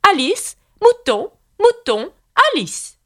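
high, slow royal introduction; ceremonious queen. A narrator's reading. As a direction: present each one in a high queenly voice. Red Queen introduces Alice and mutton. (présentation solennelle, voix de reine aiguë)

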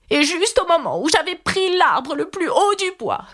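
high-pitched, aggrieved pigeon; worked-up bird voice, climbing high; rising voice. A narrator's reading. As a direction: let the complaint climb in pitch. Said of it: "dont la voix monta jusqu'à devenir".

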